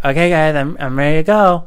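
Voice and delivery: put-on high, loud little-kid voice; eager child announcing he's ready; nursery school kid. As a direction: chirp it like a proud small child. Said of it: "it was my first day of nursery school".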